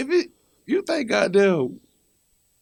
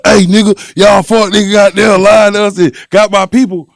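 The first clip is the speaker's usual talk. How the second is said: mock shouted callout; 11 dB louder than usual. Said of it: "be like".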